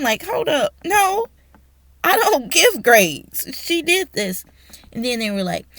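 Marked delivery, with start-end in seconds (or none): 0.2-4.4 loud, high-pitched protest; acted-out indignant objection; indignant retort. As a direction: push back emphatically, voice raised in retelling. Acted rebuttal to accusing colleagues.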